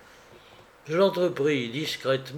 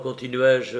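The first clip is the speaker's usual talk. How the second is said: ordinary delivery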